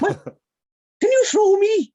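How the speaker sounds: put-on very high, loud, drawn-out child voice; mock little-girl shout; excitable little girl. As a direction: squeal a slow request like a small kid. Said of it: "she'd run up to you as a kid and she'd go".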